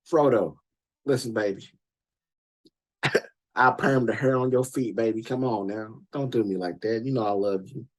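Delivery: woo Frodo like a smooth pimp; pimp sweet-talk. Retold or imitated. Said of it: "everybody else talking that pimp shit to him".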